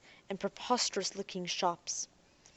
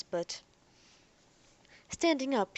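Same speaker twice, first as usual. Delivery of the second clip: plain reading voice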